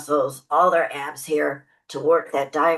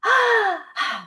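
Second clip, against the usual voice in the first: imitating a singer's heavy shoulder sigh, falling; a big falling exhale like a singer gasping mid-song; mock singer's sigh. Mocking singers who breathe with their shoulders, a heavy falling sigh. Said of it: "is when I watch a singer go".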